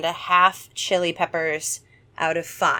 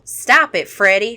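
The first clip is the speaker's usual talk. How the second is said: put-on Southern accent; 3 semitones higher, 9 dB louder than usual. Cara telling Freddy to stop scaring Tyler, Southern accent. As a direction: scold him with a Southern twang. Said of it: "She's Southern, you knew this".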